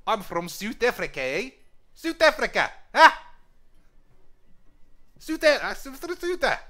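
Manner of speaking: high, loud attempt at a South African accent. Shown as a demonstration. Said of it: "do a South African accent".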